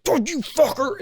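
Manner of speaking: high-pitched strained mock outburst; retold angry outburst. Comic re-enacting snapping at a heckler, mock fury. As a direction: act suddenly losing it at him.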